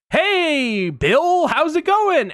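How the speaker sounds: high, bright sales-pitch hello; spring a cheerful hello on him; chirpy greeting. Retold or imitated. Salesman spotting his prospect at the airport gate, chirpy high hello.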